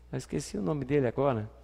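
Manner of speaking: ordinary talk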